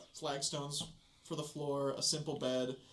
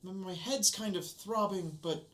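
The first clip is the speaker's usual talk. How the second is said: put-on lightly higher shaken servant girl; a hint of a dazed, then cross maid; 5 semitones higher than usual.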